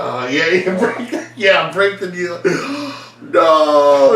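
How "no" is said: hammed-up gasp then loud high no; mock shocked gasp. Fan told wrestling is fake, mock gasp and no. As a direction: act scandalized.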